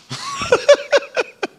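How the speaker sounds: very high-pitched wheezy laugh; high giggle. Real, not acted. Preacher amused by his own joke, high giggle.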